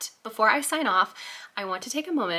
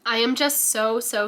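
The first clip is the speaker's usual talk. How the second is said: ordinary talk, nothing marked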